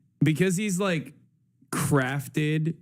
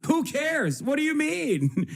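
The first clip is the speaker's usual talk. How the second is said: dismissive reply; 9 semitones higher, slower than usual. Retold or imitated. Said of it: "And I was like".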